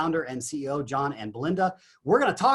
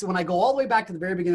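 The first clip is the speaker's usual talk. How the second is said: normal talking voice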